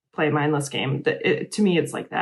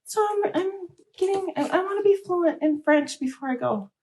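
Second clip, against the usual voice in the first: imitating friend. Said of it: "She's like".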